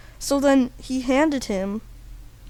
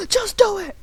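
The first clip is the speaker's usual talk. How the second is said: high-pitched urgent command; high, comic exasperation; Japanese man; 8 semitones higher, 4 dB louder than usual. Acted. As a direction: order it in a high put-on voice. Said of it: "the Japanese man says".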